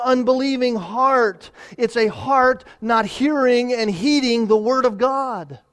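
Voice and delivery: high and loud, pressing the point home; preaching at full force. Acted. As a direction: proclaim it to the whole church.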